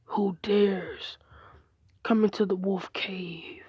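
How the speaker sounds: slow, hushed, voiceless growl; growling wolf. Acted. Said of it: "he hears a growl".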